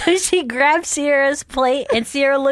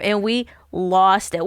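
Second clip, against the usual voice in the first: ordinary talk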